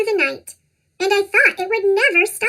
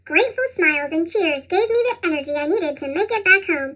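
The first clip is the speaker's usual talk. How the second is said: ordinary delivery, nothing marked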